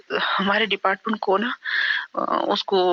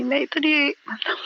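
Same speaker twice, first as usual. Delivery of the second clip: ordinary talk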